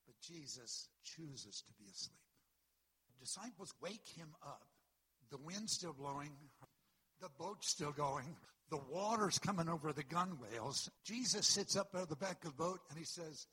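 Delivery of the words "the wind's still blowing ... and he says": louder and louder, driving the story on; building storm preaching. Real, not acted. Pastor telling the congregation of the boat in the storm, rising loud.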